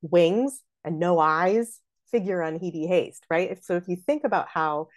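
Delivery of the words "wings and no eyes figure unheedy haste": very slow, leaning on "Wings"; trochee on wings. Shown as a demonstration. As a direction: land hard on "Wings" then ease off. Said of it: "the trochee at the top".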